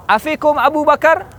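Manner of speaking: high, rising questioning call. Acted. Abu Sufyan to Muslims on the mountain, high challenging call. Commander calls up to hidden enemy.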